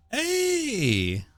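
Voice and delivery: sudden high "Hey!"; jolted, calling out; surprised exclamation. Real, not acted. Interrupts own reading aloud.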